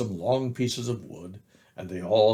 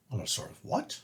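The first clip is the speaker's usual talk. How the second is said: unvoiced puzzled whisper; whispered question; 9 dB quieter than usual. A narrator's reading. Doubting voice in Pooh's song, whispered. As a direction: breathe the question. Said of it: "an anxious Pooh song".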